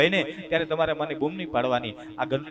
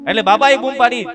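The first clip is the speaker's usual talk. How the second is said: fast, loud higher build-up; rising urgency; 10 semitones higher, 12 dB louder than usual. A narrator's reading. Preacher building to the old father's call, excited. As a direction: rush toward the punchline.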